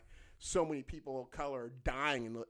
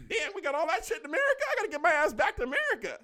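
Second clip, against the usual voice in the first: very high, loud comic exclamation; astonished outburst; 10 dB louder than usual. Retold or imitated. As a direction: burst out in hammed-up amazement. Acts own astonished reaction abroad.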